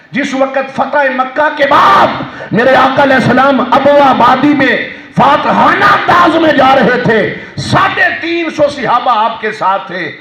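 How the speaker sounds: high, shouting preacher at full force; fiery sermon. Acted. Preacher proclaiming the Prophet's words to the congregation, shouting. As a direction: proclaim it to the whole crowd.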